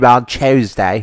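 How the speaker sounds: loud, slow mock British; hammy posh British; British accent. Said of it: "quoting as many British things".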